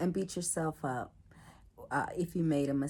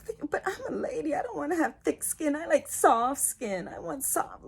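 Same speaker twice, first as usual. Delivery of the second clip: much higher, quick prissy voice; mock dainty lady; 10 semitones higher than usual. Answering the man who told her to grow thicker skin, in a high mock-ladylike voice. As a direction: play the offended lady, all delicate.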